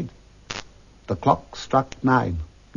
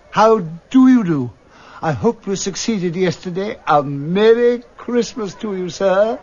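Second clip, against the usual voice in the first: warm Scrooge; 5 semitones higher than usual. Acted. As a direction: greet an old acquaintance gladly. Scrooge greeting the gentleman.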